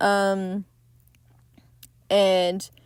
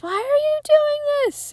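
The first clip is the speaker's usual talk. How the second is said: high, strained imitation of a frightened plea; a mock panicked woman's cry; 4 dB louder than usual. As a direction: echo the desperate plea from the trailer. Retelling frightened heroine's line in trailer.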